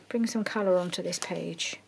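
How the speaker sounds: ordinary talk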